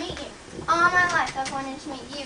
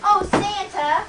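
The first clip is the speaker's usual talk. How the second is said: calling out; 4 semitones higher, 6 dB louder, slower than usual. Acted. Hidden girl calls again.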